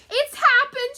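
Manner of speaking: high, loud alarmed cry; Mrs Little crying out. Acted. Mother finds the hat by the mouse hole, high loud cry. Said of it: "she cried".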